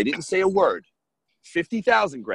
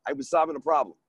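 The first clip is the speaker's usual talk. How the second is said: normal talking voice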